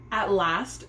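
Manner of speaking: plain reading voice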